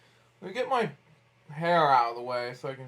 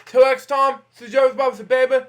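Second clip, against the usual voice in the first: loud, high, fast host sign-off; host catchphrase; 6 semitones higher, 6 dB louder than usual. Acted. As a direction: belt out the show's goodbye. Closing catchphrase of the show.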